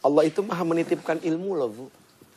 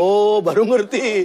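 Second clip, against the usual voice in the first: mock realization; 4 semitones higher, 7 dB louder, slower than usual. Imitating listener who finally understands.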